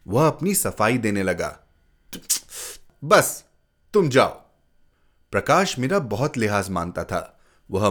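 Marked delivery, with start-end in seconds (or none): 3.0-4.3 sharp, louder brush-off; curt dismissal. Acted. Father sends the boy away with a sharp curt dismissal. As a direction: cut him off and send him away.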